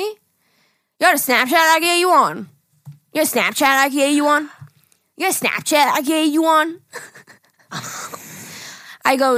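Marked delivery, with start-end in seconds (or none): 0.0-6.8 high, loud, slurred imitation; mumbled pickup line. Retelling the Walmart stranger, slurred and high. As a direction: mimic the guy's garbled come-on. Repeating his line slowly.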